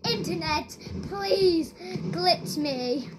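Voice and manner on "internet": ordinary delivery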